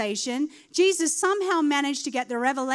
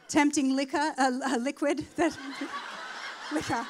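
laughing at a slip. Real, not acted.

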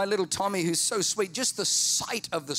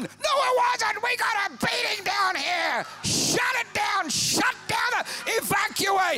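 high, loud panicked shouting; cartoon panic from the bottom; bottom's alarm; 4 dB louder than usual. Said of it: "Bottom sending message".

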